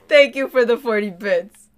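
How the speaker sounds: ordinary delivery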